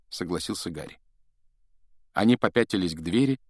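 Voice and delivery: usual speaking voice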